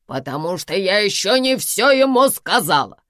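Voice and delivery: loud, emphatic proud declaration. A narrator's reading. Karina explains why she spared Samvel, proudly. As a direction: announce it triumphantly. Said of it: "гордо заявила Карина".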